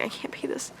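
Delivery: whispered in despair; whispering to her brother that she can't pay. Retold or imitated. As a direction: breathe the words without voice. Telling brother, overwhelmed.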